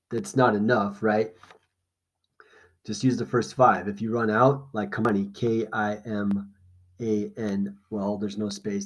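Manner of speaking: usual speaking voice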